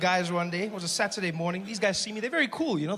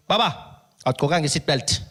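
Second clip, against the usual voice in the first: stern Xhosa cop, louder; traffic cop voice; 3 semitones lower, 5 dB louder than usual. Retold or imitated. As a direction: play the cop leaning in at the window.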